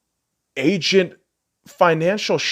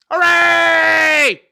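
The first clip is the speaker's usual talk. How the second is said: high, loud mock cheer; sarcastic hooray; 10 semitones higher, 5 dB louder than usual. Real, not acted. Fan mocking news of another injury, sarcastic cheer.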